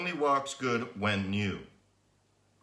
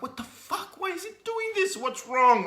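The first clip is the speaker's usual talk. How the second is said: baffled outburst. Retold or imitated. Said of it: "I'm like".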